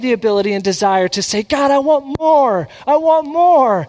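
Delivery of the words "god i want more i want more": louder and higher, slow and yearning; fervent plea. Acted. Preacher crying out to God, asking for more.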